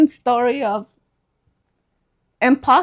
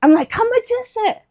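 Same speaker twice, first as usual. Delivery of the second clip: excited; 11 semitones higher, faster than usual. Retold or imitated. Eager reply to friend's circus news.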